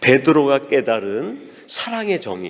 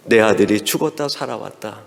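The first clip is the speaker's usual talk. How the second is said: usual speaking voice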